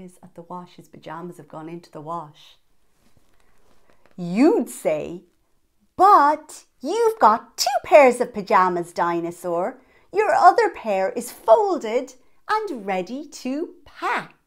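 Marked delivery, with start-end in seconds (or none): 6.0-14.4 bright reassuring voice. Acted. Child reassures Dinosaur.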